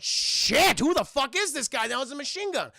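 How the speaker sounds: loud, high mock panic; hammed-up shocked swearing; alarmed outburst. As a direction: yell it like a rattled villain. Villains alarmed by the message.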